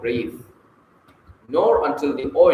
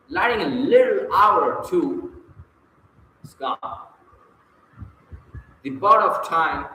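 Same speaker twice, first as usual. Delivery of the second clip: poem recital. Shown as a demonstration. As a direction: savour each line of the verse. Reads Rubaiyat as pause example.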